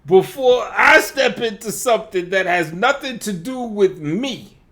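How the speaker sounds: high, loud sneering quote; loud, sing-song mock quote; mocking repeat. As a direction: throw his words back at him. Mocks the rival's line back.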